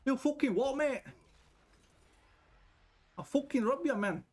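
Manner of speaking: hammed-up West Yorkshire threat; West Yorkshire thug. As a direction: play the rough West Yorkshire bloke, hammed up. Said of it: "West Yorkshire, where everybody is like".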